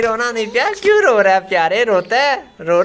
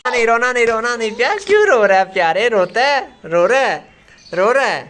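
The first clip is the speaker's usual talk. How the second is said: higher coaxing don't-cry voice; lightly raised comforting tone; 5 semitones higher, 3 dB louder than usual. Real, not acted. Soothing a crying child.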